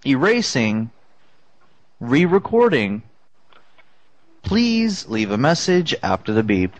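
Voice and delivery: automated prompt, press options dropping low; answering machine voice. Pretend. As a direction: recite menu like a robot system. Fake voicemail menu.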